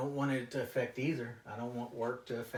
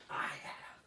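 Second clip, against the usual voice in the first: whispering; 7 dB quieter, much slower than usual. Acted. Whispers after outburst.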